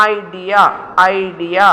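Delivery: "idea" opening on a clear eye glide; careful model "idea", each sound distinct; diphthong shown. Shown as a demonstration. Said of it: "ഐ diphthong ആണ്".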